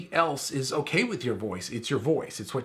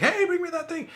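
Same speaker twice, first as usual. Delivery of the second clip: high, loud call across the house; calling the kids; 4 dB louder than usual. Retold or imitated. Parent calling to the kids for something, loud high call. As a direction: yell it casually to the kids. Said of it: "yelling out something to my kids".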